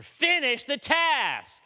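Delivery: slow, loud, high command; preached charge. Real, not acted. Preacher commanding the church to finish, slow loud charge. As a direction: hammer each word as a charge.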